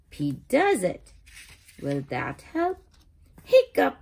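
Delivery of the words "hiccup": very high, loud hiccup; sudden squeaky hiccup. Acted. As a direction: yelp the hiccup out. Kitty hiccups in story.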